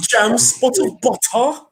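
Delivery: high, slow mock English voice; hammed-up English toff. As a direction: stretch it in exaggerated English. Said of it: "Like the English".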